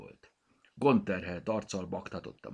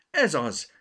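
blurted out; 9 semitones higher, 9 dB louder than usual. A narrator's reading. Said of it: "vágta rá Bogi".

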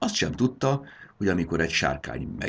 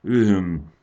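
a sleepy yawned uh-huh; lightly acted yawn; 4 semitones higher, 7 dB louder than usual. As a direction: answer through a yawn. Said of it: "ásított".

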